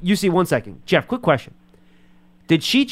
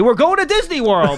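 much higher, louder mock triumphant shout; Super Bowl hero's cry; 12 semitones higher, 4 dB louder than usual. Host joking what Jeff should have told his wife, mock ecstatic shout. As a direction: ham it up like a winner on TV. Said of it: "you were gonna say".

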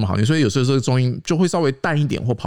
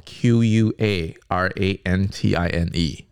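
spelling out slowly; 3 semitones lower, much slower than usual. Shown as a demonstration. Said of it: "英文可以学一下哦".

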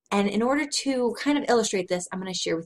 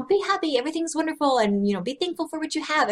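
sugary pep talk; 6 semitones higher, faster than usual. Retold or imitated. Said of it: "little messages that say".

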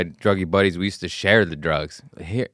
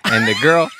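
ordinary talk, nothing marked

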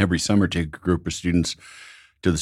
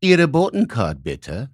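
put-on courteous request in a German accent; German flight attendant; 4 semitones higher than usual. Attendant addressing dad as a German, politely German-accented. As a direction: switch into German, high then dropping. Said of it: "and she went".

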